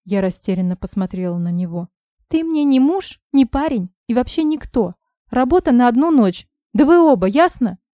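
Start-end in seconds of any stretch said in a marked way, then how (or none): 2.3-7.8 high, loud furious outburst; angry shout. Acted. Heroine yells at Misha and Vlad, furious. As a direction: let it all explode at them. Heroine blows up at both men.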